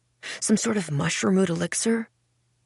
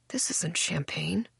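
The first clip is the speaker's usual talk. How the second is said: detached murmur; 9 dB quieter than usual. Acted. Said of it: "I heard myself say".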